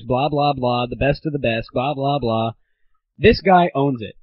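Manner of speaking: ordinary delivery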